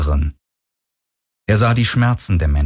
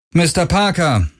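higher-pitched call without much warmth; cool call; 9 semitones higher than usual. A narrator's reading. Galen hailing an old acquaintance, calling without warmth. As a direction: call out his name, little cordiality. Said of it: "rief Galen ohne große Herzlichkeit".

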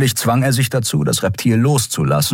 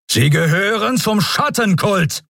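high, charged accusation; forceful accusation; 12 semitones higher than usual. Acted. Admiral accusing the captive cultist, forcefully. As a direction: hurl it as a charge.

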